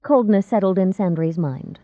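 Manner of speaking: ordinary delivery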